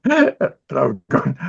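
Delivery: ordinary talk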